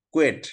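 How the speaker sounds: কুয়েট with a w-glide and sounded t; "kwet" with final t. Shown as a demonstration. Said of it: "আরেক জায়গায় উচ্চারণ কুয়ে".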